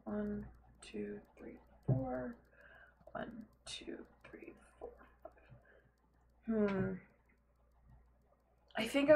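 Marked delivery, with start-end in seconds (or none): 3.1-5.3 breathy whispered numbers; whispered counting. Real, not acted. Counting blocks under breath.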